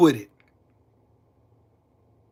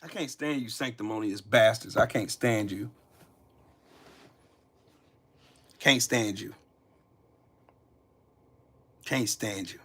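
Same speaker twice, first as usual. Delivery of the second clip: low and much softer, repeated with contempt; bitter muttering; 7 semitones lower, 10 dB quieter than usual. Real, not acted. Telling the audience he can't stand them, quiet disgust. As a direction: spit it out quietly, worn down. Bitter aside after shouting.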